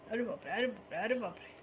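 soft, hushed oh my god; mock amazement. Mother playing along with the toy digger in mock hushed awe. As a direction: pretend to be awed, quietly.

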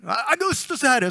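mock acclaiming cry; acted acclamation. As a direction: call it like a routine cheer for a ruler. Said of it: "Konstant fick man ropa".

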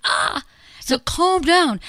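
half-earnest, exasperated demand to settle down; mock exasperation. Partner to someone at breaking point, exasperated. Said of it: "at that point where someone is like".

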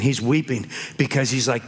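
usual speaking voice

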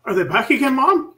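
put-on high, loud child's voice; kid. Son asking his mother about the visitors, high kid voice. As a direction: pipe up like a kid asking Mom. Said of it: "trying to look like a kid".

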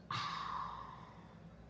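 long breathy theatrical sigh. Acted. Youtuber mid-apology, long theatrical sigh. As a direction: let out a heavy fake sigh. Apology video, dramatic pause.

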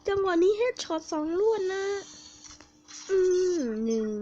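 loud, high cry of amazement; thrilled high whoop; excited shout. Real, not acted. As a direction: yell with delight at the double headshot. Double headshot reaction.

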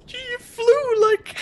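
shrieking with laughter mid-sentence; high, slow, laughing yell. Real, not acted. Laughing at flying teammate.

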